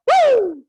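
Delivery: high, loud swooping woo; bright falsetto whoop, falling at the end; head voice "woo". Shown as a demonstration. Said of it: "That is your head voice".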